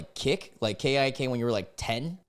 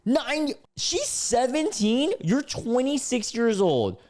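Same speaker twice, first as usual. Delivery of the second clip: loud, scandalized incredulity; appalled disbelief; 10 semitones higher, 4 dB louder than usual. Real, not acted. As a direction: exclaim aghast, pitch jumping up. Appalled at the age gap.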